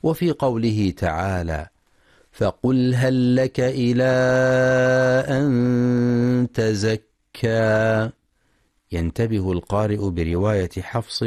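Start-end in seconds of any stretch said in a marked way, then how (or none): 2.3-8.1 slow, drawn-out tajweed chanting; measured melodic Quran recitation. Shown as a demonstration. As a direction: recite with full ghunna and long vowels. Recited verse.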